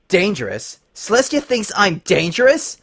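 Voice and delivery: a snarl through clenched teeth; snarled through gritted teeth. Acted. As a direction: snarl it in rage. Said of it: "Twilight snarled through gritted teeth".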